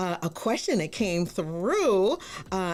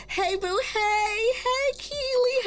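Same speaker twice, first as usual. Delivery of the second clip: high, stretched-out hey to a friend; drawn-out excited hello; slower than usual. Real, not acted. Host spotting friends in chat, long high hey.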